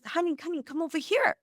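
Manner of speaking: a hint of a tender street lady beckoning; sweet old lady. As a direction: coax her over like a kindly stranger. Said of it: "and she's".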